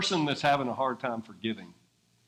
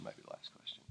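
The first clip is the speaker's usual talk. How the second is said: whispered echo, near silent; hushed repeat of "maybe the last question"; whispered aside; 22 dB quieter, faster than usual. Real, not acted. Repeats the phrase, second one whispered.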